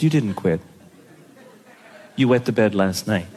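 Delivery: low, firm dad voice; stern dad. Retold or imitated. Dad refusing to let his daughter quit, low and stern. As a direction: drop low and hold the line.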